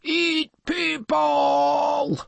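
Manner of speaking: slow, high monster bellow; big storybook monster voice; sea monster. A narrator's reading. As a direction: drag the words out like a hungry beast. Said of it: "said the sea monster".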